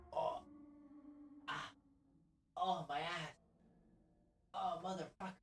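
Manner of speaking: soft high groans of pain; quiet pained moaning. Real, not acted. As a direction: whimper through a cramp.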